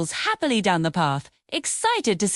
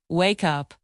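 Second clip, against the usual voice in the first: lower, settling down on up; calm teacher echo of wake up; low falling repeat; 5 semitones lower than usual. Shown as a demonstration. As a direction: drop wake up gently to close it off. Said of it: "Repeat after me".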